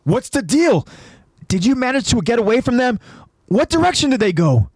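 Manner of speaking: high-pitched urgent yell; acted agitated yelling. A narrator's reading. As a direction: shout questions demanding answers. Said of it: "Jean yells out to ask".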